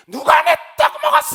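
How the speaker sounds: furious high-pitched yell, demanding an answer; angry shriek. Retold or imitated. Possessed shaman raging over a missing rice cake, piercing angry shout. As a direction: imitate a possessed shaman screaming, very high and loud. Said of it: "막 소리를 깨끗이 지르는 무당이".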